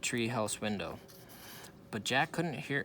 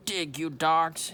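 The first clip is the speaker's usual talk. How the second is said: loud, slow pirate command, pitched up; shouted; 5 semitones higher, 6 dB louder, slower than usual. A narrator's reading. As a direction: bark the order at the crew, drawn out. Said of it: "he shouted".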